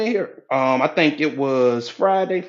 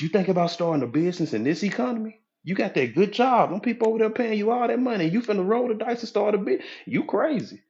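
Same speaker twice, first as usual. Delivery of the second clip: higher-pitched mock disbelief; incredulous naysayer; 8 semitones higher than usual. Doubter reacting to someone starting a business, incredulous and high.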